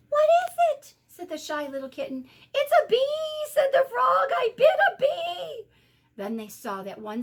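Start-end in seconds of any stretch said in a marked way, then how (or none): 0.1-0.9 alarmed. A narrator's reading. Alarmed kitten asks. 2.5-5.7 high, loud pained wailing; wailing frog. A narrator's reading. Frog wails after bee sting.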